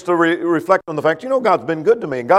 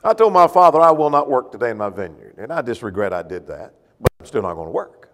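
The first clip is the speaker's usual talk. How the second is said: sulky son; 6 semitones lower than usual. Acted.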